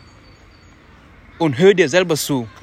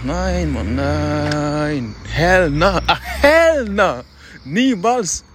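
emphatic refusal; much slower than usual. Real, not acted. Speaker refusing to accept not giving his best, defiant.

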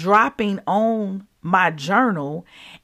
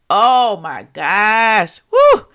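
excited; 6 dB louder, much slower than usual. Real, not acted. Seeing the dove in her tear stain, awed then whooping. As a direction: burst out in amazed joy.

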